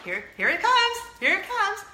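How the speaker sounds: excited high-pitched countdown; perking up. Retold or imitated. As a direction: brace for the big moment, thrilled. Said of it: "I perk up".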